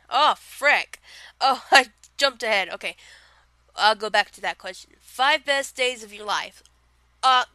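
usual speaking voice

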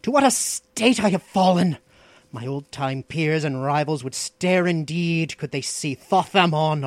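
proud sorcerer lamenting his fall, pitch surging; bitter. A narrator's reading. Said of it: "he said with unconcealed bitterness".

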